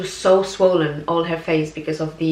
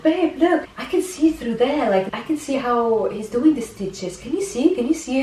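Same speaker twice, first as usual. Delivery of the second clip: high, excited pointing-out; excitedly calling her husband over to look; eager excitement; 6 semitones higher than usual. Retold or imitated. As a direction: urge him to look, thrilled. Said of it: "And I was like".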